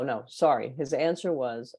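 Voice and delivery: normal talking voice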